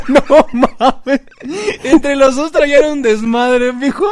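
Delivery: high, loud, slow laughing talk; laughing uncontrollably. Real, not acted. Friend laughs helplessly retelling the combi scene, high and loud.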